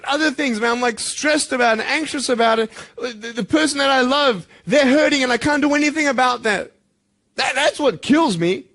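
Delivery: loud, high, near tears; emotional outcry. Real, not acted. Speaker to the students about the person he loves, crying out loud. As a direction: let it break out, loud and raw.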